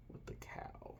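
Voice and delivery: whispered very softly. Real, not acted. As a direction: breathe the words, no voice.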